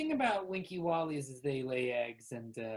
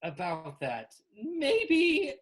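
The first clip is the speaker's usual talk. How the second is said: loud, halting, drawn-out reveal; Kimberly making a confession; 6 semitones higher, 8 dB louder, slower than usual. Acted. Kimberly admits being the true Winky Wally, halting grand delivery. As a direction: announce it grandly, a few words at a time.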